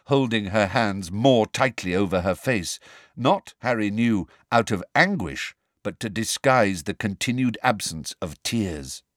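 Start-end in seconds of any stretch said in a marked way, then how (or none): none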